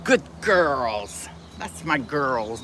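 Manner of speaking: low, hushed praise for the pets; soft praise. Real, not acted. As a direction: murmur it tenderly to the dogs.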